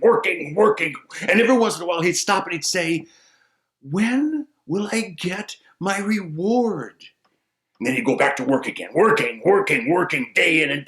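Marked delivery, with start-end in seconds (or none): 3.8-7.0 slow drawn-out sigh of a question; weary plea. Acted. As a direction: plead slowly, worn out. Simple man's weary plea.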